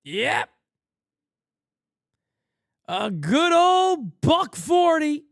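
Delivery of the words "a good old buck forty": slow, louder, drawn-out mockery; mock praise for a terrible average; sarcastic drawl. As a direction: savour a good old buck forty with sarcasm. Mocks the batting average.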